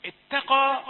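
اتقى with a thin ت, said loud; light taa. Shown as a demonstration. Teacher modelling the correct اتقى. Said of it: "كثيرون يفخمون التاء".

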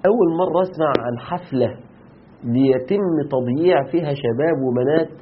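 normal talking voice

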